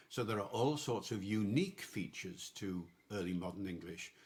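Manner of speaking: ordinary talk, nothing marked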